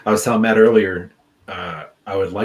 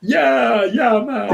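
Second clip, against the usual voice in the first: high grinning mock Jamaican drawl; bad Jamaican accent; 4 dB louder, much slower than usual. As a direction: imitate the coworker's fake Jamaican. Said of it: "just like".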